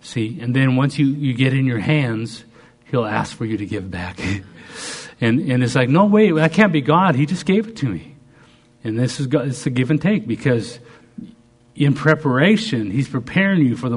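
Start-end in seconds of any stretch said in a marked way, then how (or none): 5.9-8.2 higher, louder disbelieving objection; mock protest. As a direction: protest as if it can't be true. Acts out protest at God's request.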